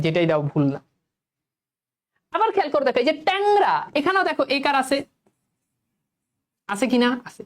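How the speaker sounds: ordinary talk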